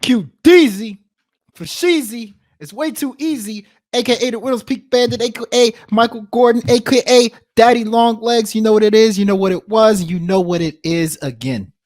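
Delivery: high, rhythmic rap-style intro; hype announcer intro. Acted. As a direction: roll out the nicknames like a hype man. Rhymed intro nickname roll call.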